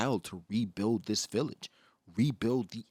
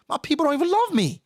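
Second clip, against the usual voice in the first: anguished outcry; 14 semitones higher, 5 dB louder, faster than usual. Retold or imitated. Loki's despairing quote rising.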